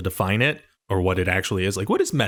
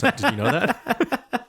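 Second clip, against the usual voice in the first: laughing; 13 semitones higher, much slower than usual. Real, not acted. Laughing disbelief at Leto story.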